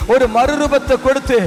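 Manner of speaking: loud, sustained proclamation; forceful pulpit declaration; full-force preaching. Real, not acted.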